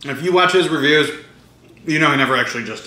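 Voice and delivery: chewing while talking; speaking between bites of pizza; mouth full. Real, not acted. Seen 2: Eating bites before; napkin at mouth while speaking, pizza in hand.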